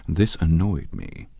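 plain reading voice

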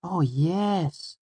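put-on very high, wondering girl character; high-pitched, drawn-out awe; wide-eyed Auri. A narrator's reading. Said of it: "her eyes wide".

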